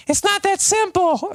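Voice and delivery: high, slow, whiny put-on voice; mock whiny wife. Husband mocking a wife's sulky complaint, high and whiny. Quoting woman in meme.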